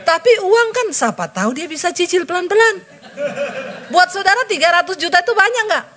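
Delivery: high, laughing joke to the audience; high, laughing joke to the congregation; laughing aside. Real, not acted. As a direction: chuckle through it, teasing the listeners.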